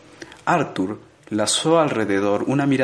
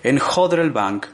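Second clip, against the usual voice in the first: plain reading voice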